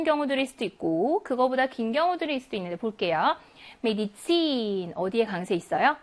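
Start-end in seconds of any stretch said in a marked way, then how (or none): 3.8-4.9 메디친 with the last syllable 친 drawn out; final-syllable stress. Shown as a demonstration. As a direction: lean on 친 in 메디친 and hold it. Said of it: "이엔에 강세가 있습니다".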